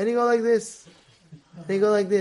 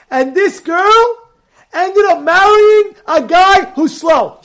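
loud, raised pitch, slow and emphatic; dramatic punchline reveal; 7 semitones higher, 7 dB louder, slower than usual. Real, not acted. Rabbi revealing his story's ending to listeners, dramatic loud reveal. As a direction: build the reveal word by word, booming by the end.

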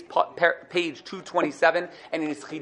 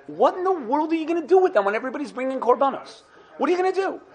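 incredulous Pharaoh; 8 semitones higher, faster than usual. Retold or imitated. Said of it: "Paro says".

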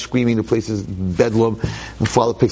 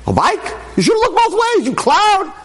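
very high, loud mock telling-off; mock scolding; 3 dB louder than usual. Preacher mock-scolding the careless boy, very high and loud. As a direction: scold the kid in a hammed-up shriek.

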